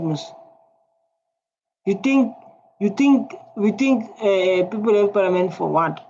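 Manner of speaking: normal talking voice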